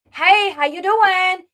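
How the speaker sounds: high, loud, drawn-out hello; acted cheery greeting. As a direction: mimic an over-friendly greeting, slow and loud. Acting out aunt's greeting on arrival.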